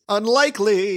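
high singsong put-on delivery; mock catchphrase. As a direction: parody a comic catchphrase. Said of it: "what they should be saying is".

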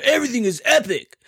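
mock hype. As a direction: parody an overused catchphrase. Mimicking overused slang.

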